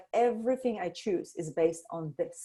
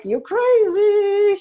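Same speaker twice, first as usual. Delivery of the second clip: mock dismissive; 13 dB louder, much slower than usual. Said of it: "that was not like".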